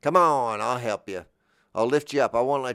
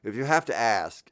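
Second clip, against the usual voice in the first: ordinary delivery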